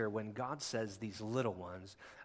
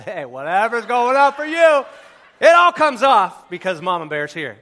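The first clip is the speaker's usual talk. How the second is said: laughing, much louder, high mock shout; mama bear shout; 11 semitones higher, 23 dB louder than usual. Pastor playing a furious churchgoing mother, laughing shout. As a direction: belt it out like an angry mama bear, half-laughing.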